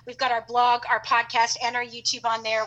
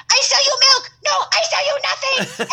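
very high, loud teasing yell in broken English; taunting the shopkeeper while walking past; laughing mock accented yell; 13 semitones higher, 7 dB louder than usual. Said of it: "I would yell out".

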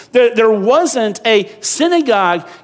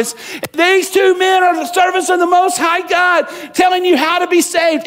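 booming voice; 10 semitones higher, 4 dB louder than usual. Retold or imitated. Paul mocking the girl's cries as a booming voice. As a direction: make it a big echoing proclamation. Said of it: "I heard this booming voice".

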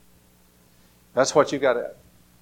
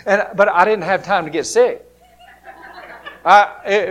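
laughing; 4 semitones higher, 8 dB louder than usual. Real, not acted.

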